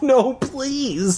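high pleading voice; begging. A narrator's reading. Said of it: "I begged".

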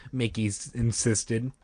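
ordinary delivery, nothing marked

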